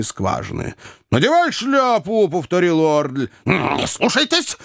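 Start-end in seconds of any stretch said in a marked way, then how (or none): 1.1-2.3 high, insistent command; exasperated repeated command; angry order. A narrator's reading. Angry brother repeats his order. 3.4-4.6 high, urgent interjection; scoundrel's sharp objection; interfering Jingle. A narrator's reading. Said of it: "вмешался Джингль".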